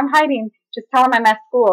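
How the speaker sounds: high, urgent plea, re-enacted; mock-frantic instructions from hiding; retold panic. Hiding granddaughter to grandma at the door, panicked. Retelling herself hiding from dad.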